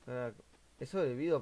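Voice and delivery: ordinary talk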